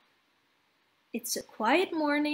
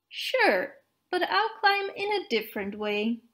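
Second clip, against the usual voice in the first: higher, bright-spirited snowman voice; a higher voice for the proud snowman; cheerful snowman; 5 semitones higher than usual. Acted. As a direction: play the eager little snowman showing off. Narrator voices Olaf.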